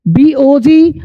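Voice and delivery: ordinary talk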